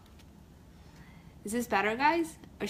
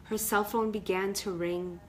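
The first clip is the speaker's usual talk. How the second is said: ordinary delivery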